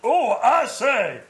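posh self-impression. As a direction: play yourself as a toff. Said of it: "I'll do an impression of me".

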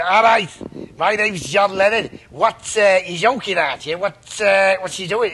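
bad [name] impression, loud; a shit [name] voice, lightly done. Cohost mocking Lennon's ghost, bad Lennon impression. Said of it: "that's a shit impression of [name]".

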